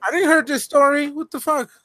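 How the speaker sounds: high-pitched, excited disbelief. Real, not acted. Friend learning of a lost bet, excited. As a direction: blurt it out, pitched well up. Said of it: "You sound so excited".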